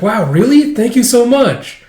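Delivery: high, loud, gushing, slowing at the end; gushing thanks. Retold or imitated. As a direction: gush over the gift like an eager junior. Fawning reply, voiced coworker.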